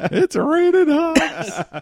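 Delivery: high, slow and laughing; excited. Pretend. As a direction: draw it out in giddy delight. Repeated catchphrase, laughing.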